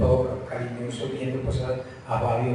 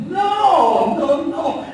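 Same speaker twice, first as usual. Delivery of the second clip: laughing no; 11 dB louder, much slower than usual. Real, not acted. Laughing rejection of mock scene.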